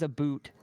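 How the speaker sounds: "a boot" with a long oo for about; YouTuber's "a boot". Retold or imitated. Quoting a YouTuber's about, "a boot" with oo. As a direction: say "a boot", rhyming with suit. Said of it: "and he says".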